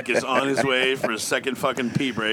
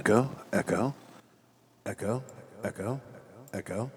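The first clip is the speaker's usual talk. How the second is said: fading repeated echo call; reverb-soaked echo test; digital echo; 6 semitones lower, 10 dB quieter than usual. Shown as a demonstration. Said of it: "Try it with some echo here".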